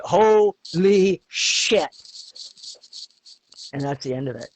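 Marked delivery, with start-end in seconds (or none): 0.0-1.9 slow, stretched "holy shit" in mock shock; drawn-out awe. Newcomer hit by prairie winter, slow stunned awe. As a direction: let it sink in, slowly. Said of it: "you're just like".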